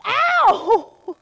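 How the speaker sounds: loud high yelp of sudden pain; sharp pained ow. Real, not acted. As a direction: yelp as if something just hit you. Blooper, hurts herself.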